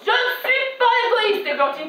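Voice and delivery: high, slow, indignant denial; outraged mother. Acted. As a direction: protest shrilly high, stretching the words. Mother retorts to accusation on phone. (mère outrée, dénégation aiguë et lente)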